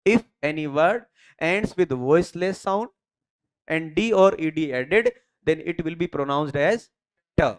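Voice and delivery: very slow, word by word dictation. Shown as a demonstration. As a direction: spell the rule out slowly for notes. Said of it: "you have to follow three conditions".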